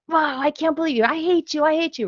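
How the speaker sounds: put-on high, whiny child yelling I hate you; hammed-up child tantrum; mock kid tantrum. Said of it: "act out with words".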